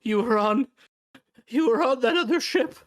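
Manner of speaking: high, loud whiny plea; pleading crippled man. Acted. Crippled man accusing Lorne of broken promises, high pleading. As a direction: beg him in a high, strained voice.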